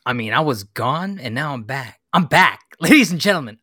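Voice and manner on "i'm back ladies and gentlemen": loud, higher proclamation; mock announcer. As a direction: announce your comeback to the crowd. Mock announcement of his return.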